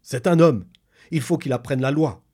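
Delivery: high, loud ape-man cry; excited declaration. Acted. The ape man insists the man must learn the law, loud and high. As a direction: proclaim it eagerly.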